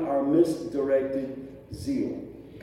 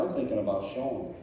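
voicing God; 7 semitones lower, 6 dB quieter, faster than usual. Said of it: "He said".

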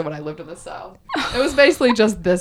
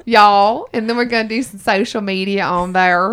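put-on Southern accent; 4 dB louder than usual. Host moves on to social media in a Southern accent. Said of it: "in my Southern accent".

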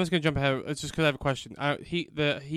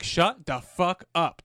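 slow, word-by-word mock command; exasperated retort; much slower than usual. Film character silencing someone's repeated calling, mock exasperated. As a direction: draw out each word as an acted put-down.

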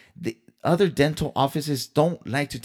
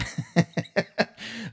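high breathy laugh; 11 semitones higher, 4 dB quieter than usual. Real, not acted. Laughing at own anger.

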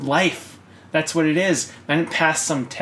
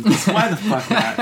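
laughing; 7 semitones higher, 4 dB louder than usual. Real, not acted. Laughing while starting his rant.

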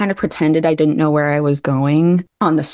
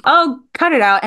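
higher-pitched mock exasperation; imitated teasing; 8 semitones higher than usual. Star teasing actress who beats herself up, mock scolding. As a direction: playfully scold to shake someone out of it. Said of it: "like, teasing her".

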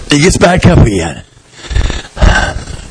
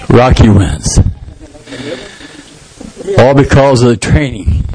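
ordinary talk, nothing marked

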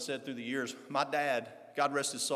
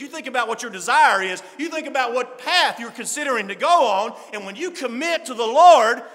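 loud, rising preacher's exhortation; full-force preaching; 12 semitones higher, 13 dB louder than usual. Acted. Preacher urging his church, at full force. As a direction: preach it hard to the congregation.

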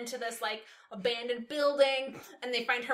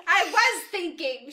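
laughing; 6 semitones higher, 8 dB louder, slower than usual. Real, not acted. Giggling while reading aloud.

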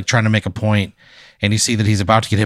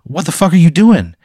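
sharp high shout, clearly louder; angry yell; 8 semitones higher, 5 dB louder than usual. Acted. Rescuer yelling at the man he just pulled from traffic, angry shout. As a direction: yell at him for stepping into traffic. Player character yells at reckless guy.

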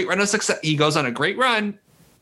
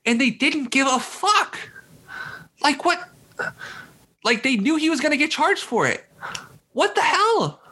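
seething rant; 8 semitones higher than usual. Real, not acted. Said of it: "I'm legitimately seething".

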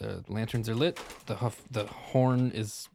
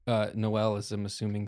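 ordinary delivery

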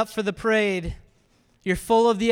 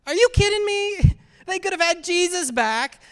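high, louder, disbelieving protest; incredulous outburst; 8 semitones higher, 3 dB louder than usual. Acted. Preacher reacting to the crowd's shocking choice, incredulous. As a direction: burst out in disbelief, pitched way up.

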